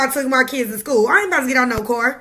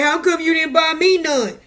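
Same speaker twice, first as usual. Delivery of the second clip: mock whiny complainer; 4 semitones higher than usual. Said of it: "if somebody say".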